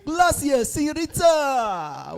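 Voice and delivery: high, slow hammed-up Spanish; exaggerated Spanish greeting voice; mock Spanish accent.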